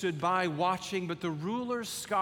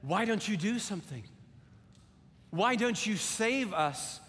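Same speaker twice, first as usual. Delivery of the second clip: mild angry demand; 6 semitones higher than usual. Acted. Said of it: "He said".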